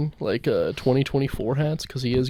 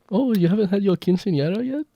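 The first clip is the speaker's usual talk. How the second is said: high, put-on mock Mexican accent; 7 semitones higher than usual. Mocking a creep with a bad Mexican accent. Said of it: "This is a Mexican accent".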